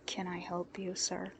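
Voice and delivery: quiet, level and unbothered; dangerously polite calm. Acted. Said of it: "he asked calmly".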